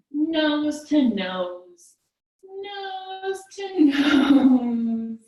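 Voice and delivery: very slow, drawn-out cutesy nuzzle; nuzzling cat. Pretend. Teacher's cat nuzzles child nose to nose, slow playful coo.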